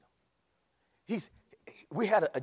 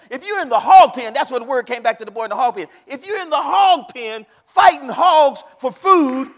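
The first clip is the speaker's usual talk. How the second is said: full-force preaching; 11 semitones higher, 20 dB louder than usual. Acted. Preacher shouting to the congregation about God meeting them in the mess. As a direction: shout it to the back pews, building to a roar.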